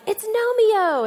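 put-on high, slow, delighted toddler voice; a small boy thrilled at his find; excited little boy. Little son spotting a garden gnome, high and delighted. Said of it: "and he was like".

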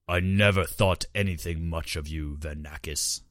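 lightly voiced giant daedra prince; dim slow booming giant. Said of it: "the giant boomed".

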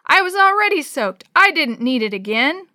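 high, indignant yelling; loud protest. A narrator's reading. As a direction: complain loudly after a drenching. Soaked child protests after screech.

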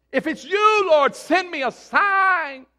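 a touch of whiny pleading; mock pleading believer. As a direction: imitate someone begging for a sign. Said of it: "We do that all the time".

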